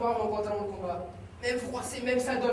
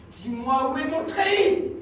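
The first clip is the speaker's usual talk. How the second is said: slow, louder, higher press; insistent demand; 5 semitones higher, 4 dB louder, slower than usual. Acted. Son presses his mother once more for the uniform. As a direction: push the question hard.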